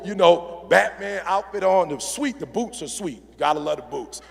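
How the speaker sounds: ordinary delivery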